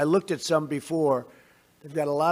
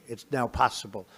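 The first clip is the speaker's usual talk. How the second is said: ordinary talk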